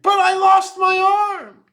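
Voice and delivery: high, forceful outburst; loud exclamation. A narrator's reading. Swamp local protesting to the trapper, exclaiming. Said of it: "One of them exclaimed".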